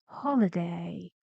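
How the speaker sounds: low, sinking end on "holiday"; falling intonation. Shown as a demonstration. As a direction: step down low through "holiday". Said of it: "It has to go even lower".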